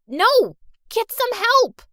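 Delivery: high, loud yell for help; desperate shout. Acted. Hurt girl yelling to her friend to fetch help. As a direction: scream it after her.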